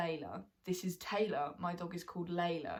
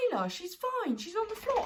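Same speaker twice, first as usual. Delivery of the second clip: mum voiced; 11 semitones higher than usual. Mum voiced pointing at dog.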